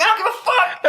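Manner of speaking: very high comic outburst; exasperated mock yell. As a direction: yell back, voice cracking up into the top. Acted bathroom-door quarrel.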